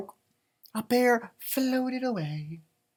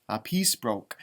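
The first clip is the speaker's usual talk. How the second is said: ordinary delivery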